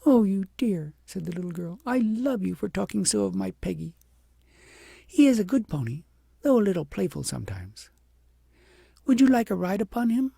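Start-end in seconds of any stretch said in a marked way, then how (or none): 0.0-0.9 put-on higher and louder, delighted child; a child warmly fond; little girl answering princess, delighted; affectionate little girl. A narrator's reading. 1.8-10.3 put-on higher and louder, delighted child; a child warmly fond; little girl answering princess, delighted; affectionate little girl. A narrator's reading.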